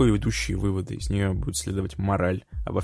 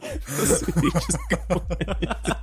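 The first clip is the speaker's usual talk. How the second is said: high voice through giggles; laughing; 11 semitones higher, slower than usual. Real, not acted. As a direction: laugh while saying it. Giggling over "spherical.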